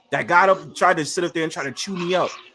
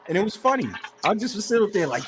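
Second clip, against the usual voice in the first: ordinary delivery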